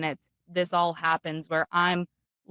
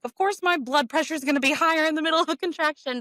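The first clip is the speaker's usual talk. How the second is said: exasperated; 8 semitones higher, faster than usual. Retold or imitated. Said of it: "I'm like".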